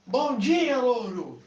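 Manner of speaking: high cheery call; cheery greeting. Acted. Woman greets the still silent parrot. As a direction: sing out hopefully.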